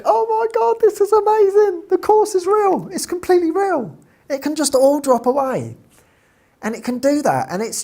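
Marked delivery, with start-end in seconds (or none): 0.0-5.8 far higher and louder, elated; ecstatic. Retold or imitated. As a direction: burst out with joy. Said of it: "And I was like".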